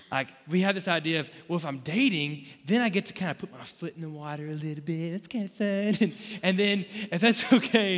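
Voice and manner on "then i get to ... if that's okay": higher, louder goofy voice; hammed-up, giggly self-justifying voice; silly put-on voice. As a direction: play a dater testing the limits in a silly voice. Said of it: "I don't know why I'm using that voice".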